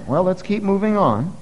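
normal talking voice

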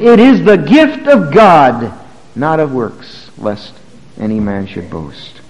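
slow, then fading to a hush; solemn scripture reading. Acted. Quoting scripture, slow to hushed.

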